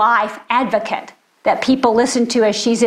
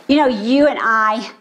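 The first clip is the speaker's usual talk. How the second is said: ordinary delivery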